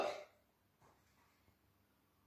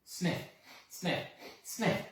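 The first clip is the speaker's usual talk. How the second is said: sniffing; 5 semitones higher, 3 dB quieter, slower than usual. A narrator's reading. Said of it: "What could she smell?".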